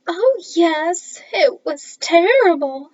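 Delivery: high, loud wail. Acted. Lucy Lockett telling the reporter about the death in a high, loud wail. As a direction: wail it out, ear-splitting. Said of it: "she wailed".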